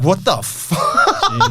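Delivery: drawn-out, laughing what the fuck; slow, stunned disbelief breaking into a laugh. Retold or imitated. Stunned by his ball knowledge.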